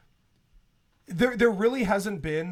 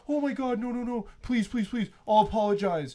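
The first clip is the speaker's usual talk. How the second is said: mock panicked apology; 2 semitones higher, 4 dB louder, faster than usual. Mocking a cancelled star who begs forgiveness. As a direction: beg like a cancelled celebrity, hammed up. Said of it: "Instead of everybody else like".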